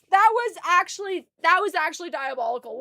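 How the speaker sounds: ordinary talk, nothing marked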